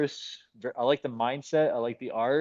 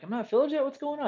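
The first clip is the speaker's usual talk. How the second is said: incredulous; 12 semitones higher, faster than usual. Retold or imitated. Retold unfilled order.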